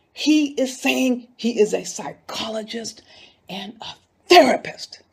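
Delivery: breathy indignant whisper. Real, not acted. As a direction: hiss it out in disbelief, barely voiced. Indignant aside about his claims.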